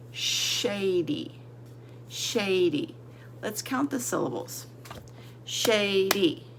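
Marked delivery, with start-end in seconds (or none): none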